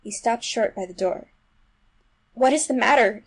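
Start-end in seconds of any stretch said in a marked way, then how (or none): 2.3-3.2 loud, raised-pitch demand; alarmed cry. A narrator's reading. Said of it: "he cried".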